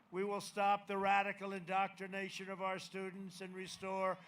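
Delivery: loud, rousing campaign promises; rally the crowd with conviction; rally speech. Acted.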